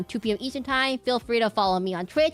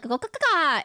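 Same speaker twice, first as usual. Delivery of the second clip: hawk caw; 7 semitones higher, 4 dB louder than usual. Retold or imitated.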